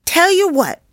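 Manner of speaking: high, loud burst of an idea; excited boy. A narrator's reading. Joey finally hitting on a plan for the kids, excited. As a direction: pipe up high with a bright new plan. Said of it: "his eyes sparkling".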